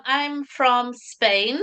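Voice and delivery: normal talking voice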